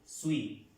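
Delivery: Suis said "swee" with no S at the end; silent final S. Shown as a demonstration. Teacher to students, Suis with a silent S. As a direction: drop the S in Suis. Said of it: "La S no la pronunciamos".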